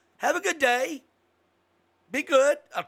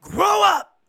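barked, much higher and louder movie-colonel yell; Frank Slade shout; 12 semitones higher, 10 dB louder than usual. Retold or imitated. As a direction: bellow it like a blind army colonel losing patience. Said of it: "he's a scene in the movie where he's talking and he goes".

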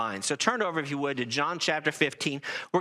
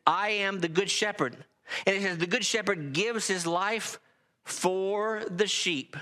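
raised, ringing scripture reading; 4 semitones higher than usual. Acted. Preacher reading Jesus's words to the congregation, raised and ringing. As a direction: read the verses out like a pulpit proclamation. Preacher reads John ten aloud.

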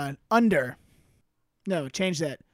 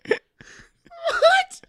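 a high squeak through laughter; asking while cracking up; laughing; 11 dB louder than usual. Real, not acted. Laughing at James Buchanan answer.

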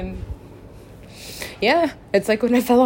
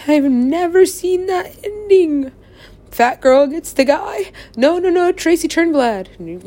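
tearful, worn out; 8 semitones higher, 8 dB louder, slower than usual. Real, not acted. Emotional, cry heard on 162.

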